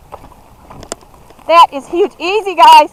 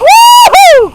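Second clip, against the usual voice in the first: high loud woo-hoo whoop; 9 dB louder than usual. Real, not acted. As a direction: whoop with joy.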